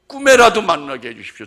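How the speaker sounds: loud, pleading prayer. Retold or imitated. Pastor voicing a parent's prayer, loud pleading. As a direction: cry out the prayer to God.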